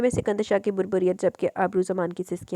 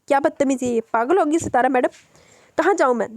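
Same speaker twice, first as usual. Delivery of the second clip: higher, louder angry protest; angry cry; 6 semitones higher, 5 dB louder than usual. Acted. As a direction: snap back at the madam. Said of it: "ज़ोर से चीखी". (ऊँची, गुस्से भरी चीख)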